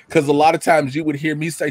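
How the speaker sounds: normal talking voice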